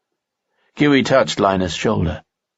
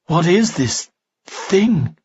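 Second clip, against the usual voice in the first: higher, slow horrified gasp; an elf seeing the mangled monster, horrified gasp; 9 semitones higher, slower than usual. A narrator's reading. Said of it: "gasped Kalia in horror".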